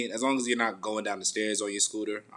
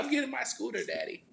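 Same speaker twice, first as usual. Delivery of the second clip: put-on child's voice; 4 dB quieter than usual. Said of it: "She's like".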